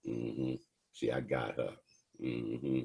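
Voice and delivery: quietly gloating she's got her; smug lady cop. Retold or imitated. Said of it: "the lady cop is like".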